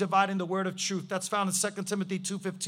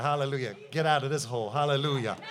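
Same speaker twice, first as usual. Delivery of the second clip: ordinary talk, nothing marked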